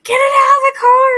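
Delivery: high, loud mock yell at her; imitating Dave yelling to get it out; husband shouting in alarm; retold shout. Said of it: "And Dave's like".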